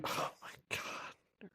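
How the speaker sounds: slow, hushed disbelief; stunned whisper. Retold or imitated. Teacher reacts to kindergartner's slang, stunned whisper. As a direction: whisper it, drawn out and aghast.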